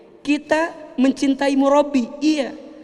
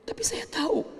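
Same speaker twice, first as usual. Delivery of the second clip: whispered prayer; 11 semitones higher, 8 dB quieter than usual. Retold or imitated. Acted grieving prayer turns inward.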